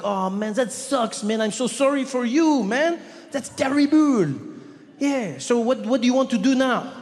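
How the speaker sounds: put-on high, effusive French-accented sympathy; cool French guy. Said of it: "but very French, very French, cool guy".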